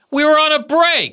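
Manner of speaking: high, indignant sitcom yell; Ross. Retold or imitated. Guest recalling a Friends line, hammed-up Ross impression. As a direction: shout the catchphrase high and exasperated. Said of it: "Ross impression".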